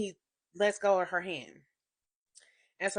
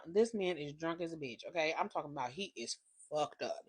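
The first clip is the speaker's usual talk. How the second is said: normal talking voice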